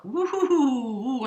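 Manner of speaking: slow sing-song woo-hoo; excited whoop. Real, not acted. Reader thrilled by the card pulled, slow joyful hoot. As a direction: let out a delighted hoot.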